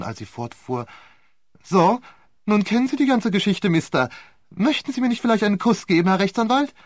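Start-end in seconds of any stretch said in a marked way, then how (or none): 1.6-6.7 shrill-free but much higher, louder, brash and fast; vulgar voice. Acted. Romain mocks the barrister in a brazen vulgar voice. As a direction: drop the lady act, go coarse and cheeky. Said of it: "völlig veränderten, vulgären Ton".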